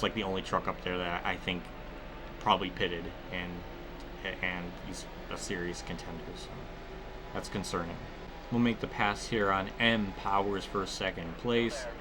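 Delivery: normal talking voice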